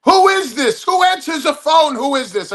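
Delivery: high-pitched mock outrage at a question; indignant mimicking. Caller scolds comic's phone manner, indignant mimicking. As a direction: throw the question back, high and incredulous.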